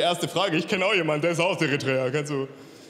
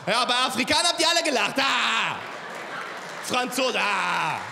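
mock outburst; 5 semitones higher than usual. Comedian scolding audience for laughing at Africans, mock outburst. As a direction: shout it out and end on a held cry.

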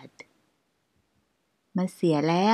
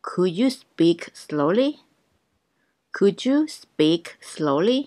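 very slow, each syllable clear; slow teaching pace; slow drill; much slower than usual. Shown as a demonstration. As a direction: spell it out slowly for learners. Slow drill repeated twice.

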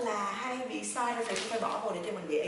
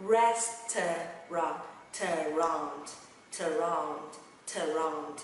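"Restaurant" broken slowly into "res - tuh - rahnt"; slow syllable demo; 4 semitones lower, much slower than usual. Shown as a demonstration. Teacher drilling learners before speeding up, very slow "Restaurant". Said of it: "Đọc nhanh ha".

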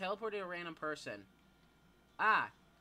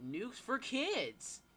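ad announcer; 8 semitones higher, 3 dB louder, much slower than usual. Acted. Announces item like an ad.

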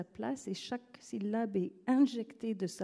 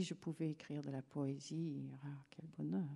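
wistful whisper; 3 semitones lower, 8 dB quieter than usual. Real, not acted. Wistful thought about writing poetry.

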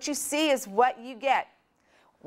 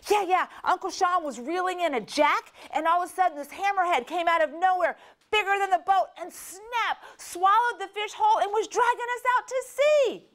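put-on much higher, thrilled little girl's tale; breathless excited child; 10 semitones higher than usual. Little girl telling mom about the shark, high and thrilled. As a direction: tumble out the story in a rush of excitement. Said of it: "And Emily goes".

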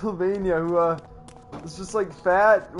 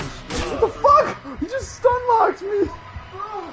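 angry outburst; 13 semitones higher, much slower than usual. Real, not acted. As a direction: yell it in outrage. Stun locked by enemy.

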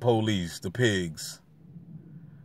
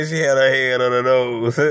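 words pushed out through laughter; cracking up mid-sentence; giggling through the line; laughing; 4 dB louder than usual. Real, not acted. Laughing while telling funny sighting.

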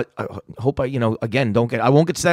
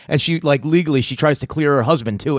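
ordinary talk, nothing marked

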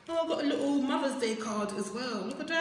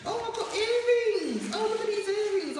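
excited squeal; 10 semitones higher, 5 dB louder than usual. Real, not acted. Opening box finds earrings.

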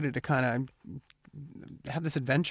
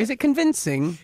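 put-on British accent; 7 semitones higher, 4 dB louder than usual. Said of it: "You sound like David Cameron".

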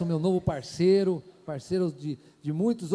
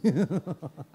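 short laugh; 3 semitones lower, 5 dB quieter than usual. Real, not acted. Laughs after car donation prank.

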